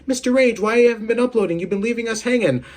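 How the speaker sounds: high-pitched mock fan complaint; complaining viewer. Viewers nagging him for not uploading, mock complaint. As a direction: imitate a whining fan, pitched well up.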